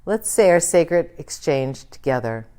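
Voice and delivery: normal talking voice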